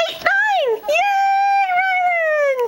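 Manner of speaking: high thrilled counting and cheering; excited cheer. Real, not acted. Mother cheering Rylan's steps, high and thrilled. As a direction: cheer her across the room.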